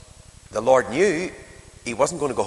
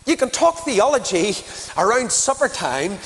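desperate begging; 7 semitones higher than usual. Acted. Father begging Jesus to come now, high desperate begging. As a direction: beg him to come now.

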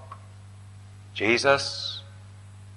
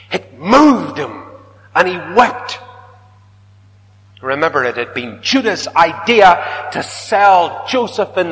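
impassioned preaching; 7 semitones higher, 7 dB louder than usual. Acted. Preaching rises to full force.

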